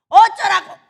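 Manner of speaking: high, very loud retort; big high-pitched dismissive shout; defiant shout. Acted. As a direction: yell it out in one burst of defiance.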